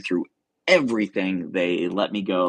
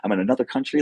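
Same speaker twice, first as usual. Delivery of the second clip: higher-pitched gush of wonder; excited; 6 semitones higher, faster than usual. Retold or imitated. Traveler marveling at being in Canada, excited. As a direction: retell being thrilled at where you are. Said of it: "I'm excited".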